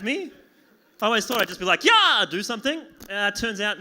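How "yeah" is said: high, loud kiai-style shout; martial-arts yell. Acted. Comic imagining himself striking back in the dark, martial-arts yell. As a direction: burst out with a fighting yell.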